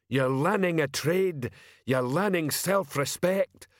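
a hint of slow troll recruiting drone; slow, lightly voiced troll pitch; troll sergeant. A narrator's reading. Troll sergeant recruits passers-by, slow and lightly voiced. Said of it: "shouted Sergeant Detritus".

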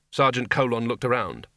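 plain reading voice

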